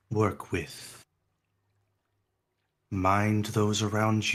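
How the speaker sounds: soft, slow, fading counsel; an ancient heart giving a gentle warning; hushed heart voice. Acted. The heart speaks again.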